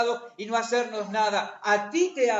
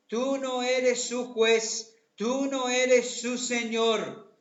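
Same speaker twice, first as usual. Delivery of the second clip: slow emphatic reading; 4 semitones higher, 4 dB louder, much slower than usual. Acted. Preacher stressing the verse.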